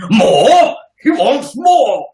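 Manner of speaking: a hammed-up starving orphan's shout; Oliver Twist pleading. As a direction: cry out like a hungry orphan. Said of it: "he wants more gruel".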